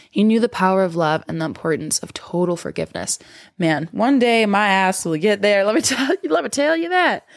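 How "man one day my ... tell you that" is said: high, playful sass rising; sassy voice. Pretend. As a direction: tease yourself in a sassy voice. Playful self-mocking aside.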